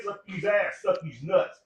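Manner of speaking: ordinary talk, nothing marked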